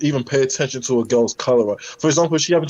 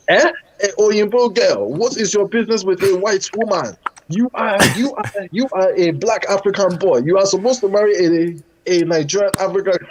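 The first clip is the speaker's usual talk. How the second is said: put-on high Nigerian accent, scolding her son; Nigerian mum accent; 7 semitones higher than usual. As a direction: play the Nigerian mother, outraged and high. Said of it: "do the African accent and do how she did".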